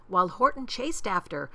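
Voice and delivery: ordinary delivery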